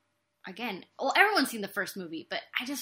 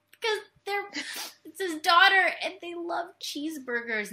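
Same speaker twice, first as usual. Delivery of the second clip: laughing through tears; 7 semitones higher, 4 dB louder than usual. Retold or imitated. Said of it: "I was like".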